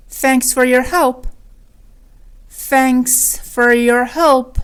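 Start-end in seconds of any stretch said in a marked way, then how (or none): 2.7-4.6 drawn-out teaching repeat; slow and segmented. Shown as a demonstration. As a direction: stretch "Thanks", then "for your help" with gaps. Lesson repeats it slowly. (آهسته و شمرده)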